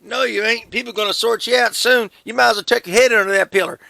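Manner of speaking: high, loud hammed-up Southern parent; Southern scolding. Singer topping the joke with a loud Southern scold. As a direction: bellow it like a country mama. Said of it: "That was really good".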